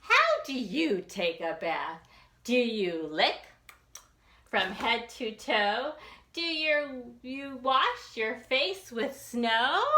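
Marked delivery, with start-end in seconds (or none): none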